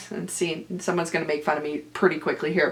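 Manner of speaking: usual speaking voice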